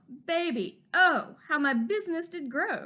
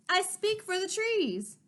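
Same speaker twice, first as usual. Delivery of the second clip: slow, high, earnest cry; cried out; 6 semitones higher than usual. A narrator's reading. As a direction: proclaim it slowly, voice lifted. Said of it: "cried the Lorax".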